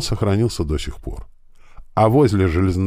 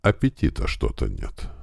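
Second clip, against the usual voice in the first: low, subdued answer, softer at the end; curt reply; 9 semitones lower, 5 dB quieter than usual. A narrator's reading. Kalugin answers the colonel before the dead, curt and low. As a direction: answer briefly in a lowered voice. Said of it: "коротко отозвался".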